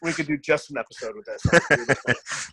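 slow, laughing through the words. Real, not acted. Joking to the host about a whole episode, laughing. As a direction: let the laugh carry the line.

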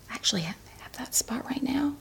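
half-whispered, quick aside; real sudden discovery, almost whispered; hushed aside. Real, not acted. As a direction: murmur it quickly to yourself. Discovers a bald spot mid-story.

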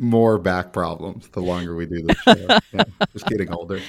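loud words broken by laughter; laughing. Real, not acted. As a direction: push the joke out through laughs.

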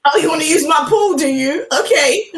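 mock threat, laughing. Threatening the pool-using mum.

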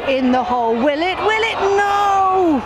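tense questions climbing into a yelled no; excited build to a shout. Real, not acted. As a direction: build the suspense to a cry of disbelief. Commentator watching a putt.

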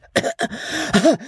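high, choked cough; acted sneezy splutter. A narrator's reading. Chief interrupted by a sneezing fit, spluttering. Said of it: "between two sneezes".

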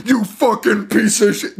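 high, drawn-out cursing outburst; mock screaming rage. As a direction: curse it out like an overwrought dad. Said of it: "erupt into screaming".

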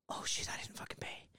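unvoiced whisper of dismay; whispered panic. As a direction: hiss it out like a guilty secret. Retold realization.

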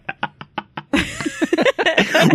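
real high laugh. Real, not acted. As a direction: bursts out laughing at the remark. Host laughs at Alabama remark.

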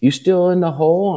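put-on a touch of a little boy asking from above; voicing his little son. Son calling down to dad in the well, lightly childlike.

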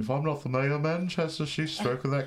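blustering northern English mayor, hammed up; British man. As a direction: ham up a Manchester accent. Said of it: "an early seventies British man".

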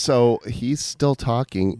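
ordinary delivery

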